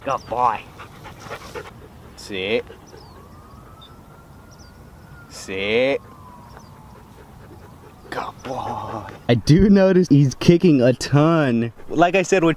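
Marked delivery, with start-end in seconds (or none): 0.0-9.0 talking to a dog. Real, not acted. Commands and praise to dog.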